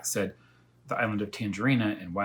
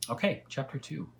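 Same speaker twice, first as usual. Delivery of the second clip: ordinary delivery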